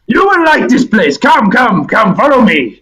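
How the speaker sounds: high, hearty Russian creature voice; heavy Russian accent. Acted. Tower creature welcoming Sal, heavy Russian accent. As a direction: greet them in thick Russian-accented English. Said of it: "So a deep Russian voice".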